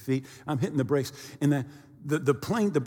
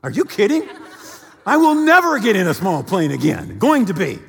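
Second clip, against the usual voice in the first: higher and louder, laughing disbelief; incredulous outburst; 5 semitones higher, 8 dB louder than usual. Retold or imitated. Preacher retelling his reaction to his wife, loud and incredulous. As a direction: throw the words back in mock outrage. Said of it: "And I thought".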